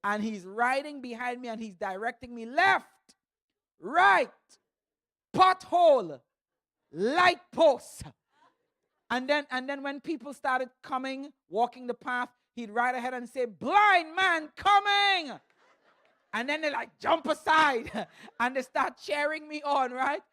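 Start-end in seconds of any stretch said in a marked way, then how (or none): 2.5-8.0 loud, higher call in single words; calling out directions. Retold or imitated. Buddy riding behind the speaker, calling out directions. 13.5-15.4 loud, high, drawn-out shout; calling out warning. Retold or imitated. Buddy warning walkers on the path, calling out long and loud.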